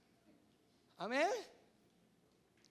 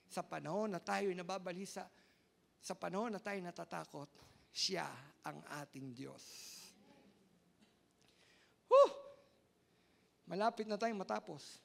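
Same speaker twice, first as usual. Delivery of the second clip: ordinary talk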